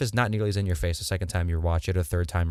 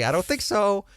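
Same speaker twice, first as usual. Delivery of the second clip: sassy mock refusal; 12 semitones higher than usual. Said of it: "it's like".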